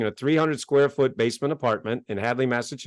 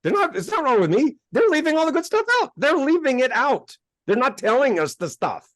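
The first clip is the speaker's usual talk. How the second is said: excited realization; 10 semitones higher than usual. Retold or imitated. Excited self-quote, realizing.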